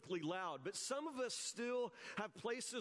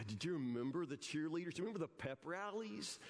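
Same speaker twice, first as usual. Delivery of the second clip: ordinary talk, nothing marked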